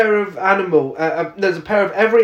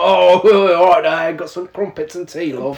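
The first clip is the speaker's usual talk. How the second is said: a light, laughing mock British accent. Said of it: "mock Veronica Shade's shit British accent".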